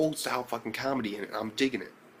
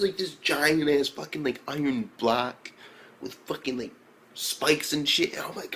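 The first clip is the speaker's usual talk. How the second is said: ordinary delivery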